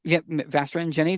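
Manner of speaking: ordinary talk, nothing marked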